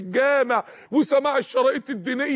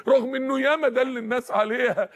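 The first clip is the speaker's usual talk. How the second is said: usual speaking voice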